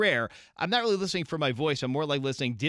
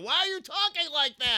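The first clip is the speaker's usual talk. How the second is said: put-on bratty kid yell; 13 semitones higher than usual. Jerk kid yelling at mom on the phone, high and whiny. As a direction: call out like a cheeky kid. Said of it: "yell in the background".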